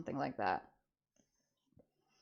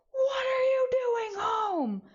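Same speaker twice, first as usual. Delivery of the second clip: much higher, slow, loud shriek; mom yelling; 5 dB louder, slower than usual. Retold or imitated. Mom catching her kid home from school, loud high yell. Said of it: "She's like".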